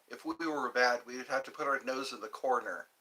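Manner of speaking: normal talking voice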